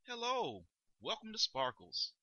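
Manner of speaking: high-pitched store greeter hello; rising customer-service greeting. Acted. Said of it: "they kinda rise up".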